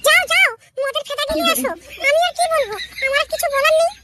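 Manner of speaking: high-pitched scolding wife, mocking disbelief; a nagging wife who doesn't buy his promise; sarcastic wife. Wife mocks husband's promise to quit drink, high and scornful. Wife's voice again.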